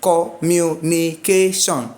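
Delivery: slow, evenly spaced syllables of COMMUNICATION. Shown as a demonstration. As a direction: break COMMUNICATION into five drawn-out beats. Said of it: "has five syllables".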